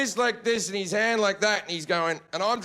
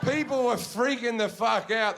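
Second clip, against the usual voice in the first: ordinary talk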